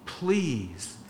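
soft, slow plea; God's tender slow plea; gentle pleading. Retold or imitated. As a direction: beg softly and slowly. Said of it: "the Lord is pleading".

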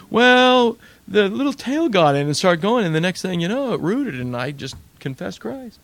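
eager convert. Acted. The convert explains to the old friend how faith took root, eager and excited, then easing off. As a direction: tell your story bubbling over, then calm into the confession.

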